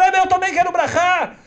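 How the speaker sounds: put-on a girl at the rabbi's door; begging little girl. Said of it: "bate na porta e fala". (menina pedindo, aguda e alta)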